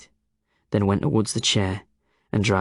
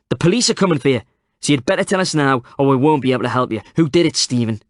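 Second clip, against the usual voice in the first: loud, urgent demand; 5 semitones higher, 6 dB louder, faster than usual. A narrator's reading. Tom pressuring the old man, loud and demanding. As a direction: press him hard for an answer. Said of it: "Tom demanded".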